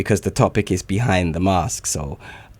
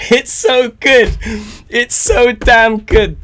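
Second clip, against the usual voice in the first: high, loud and slow gush; hype reaction; 11 semitones higher, 6 dB louder, slower than usual. Real, not acted. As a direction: drag it out, overjoyed. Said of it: "quite a hype reaction".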